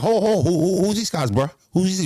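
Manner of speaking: Boston doorman asking who these guys are, mildly hammed; Boston accent. Kenny at the door quizzing the crew, light Boston accent. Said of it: "little, um, uh, Boston accent".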